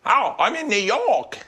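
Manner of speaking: high, drawn-out put-on "New York"; hammed-up outsider overdoing New York; mock tourist's New York. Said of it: "You're gonna go".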